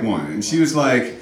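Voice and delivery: ordinary delivery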